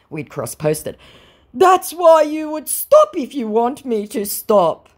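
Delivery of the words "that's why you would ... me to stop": higher, louder, slow; hammed-up muddled reply; scammer's nonsense, mocked. Voicing scammer.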